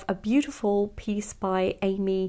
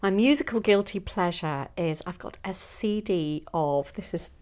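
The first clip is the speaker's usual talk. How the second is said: ordinary delivery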